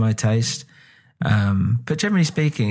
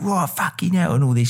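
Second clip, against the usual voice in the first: mock Cockney, pitched high; 7 semitones higher than usual. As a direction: overplay a Cockney exclamation. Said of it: "And he's all like".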